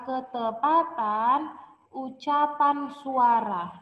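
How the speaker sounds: ordinary talk, nothing marked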